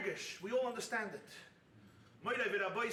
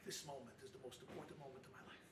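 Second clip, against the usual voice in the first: hushed conclusion; 12 semitones lower, 17 dB quieter, faster than usual. Real, not acted. Hushed closing line of sermon.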